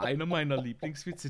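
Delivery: ordinary talk, nothing marked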